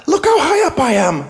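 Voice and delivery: put-on very high, loud boasting kid; excited child voice. A narrator's reading. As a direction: crow it like a kid up a tree.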